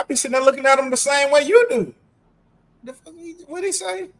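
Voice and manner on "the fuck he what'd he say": baffled. Retold or imitated. Confused at Georgia speech.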